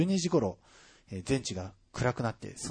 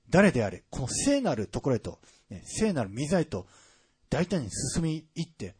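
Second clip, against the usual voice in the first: raised, rising sermon delivery; fervent preaching; 4 semitones higher, 4 dB louder than usual. Acted. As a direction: preach it with growing conviction. Preaching climax on the veil.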